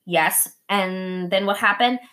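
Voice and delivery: normal talking voice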